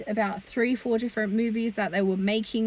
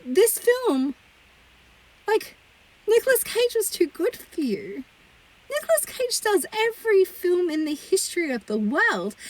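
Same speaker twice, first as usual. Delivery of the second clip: exasperated rant; 8 semitones higher than usual. Real, not acted.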